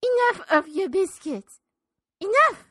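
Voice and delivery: high, loud shriek of enough; indignant shout. Acted. Old woman to the reverend, shrieking enough. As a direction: blow up at him.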